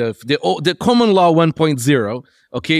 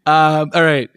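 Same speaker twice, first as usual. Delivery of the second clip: ordinary talk, nothing marked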